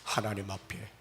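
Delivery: usual speaking voice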